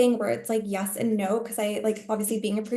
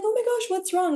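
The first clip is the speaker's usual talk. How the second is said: concerned; 11 semitones higher than usual. Retold or imitated. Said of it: "and I'm like".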